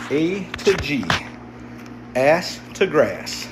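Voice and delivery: spelled out slowly, each word given weight; slow it down so everyone gets it; slow explanation. Shown as a demonstration. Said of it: "Who knows what A to G is?".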